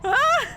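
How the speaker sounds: sudden frightened yelp; scream of fright. Real, not acted. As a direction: shriek in panic. Panicked at car.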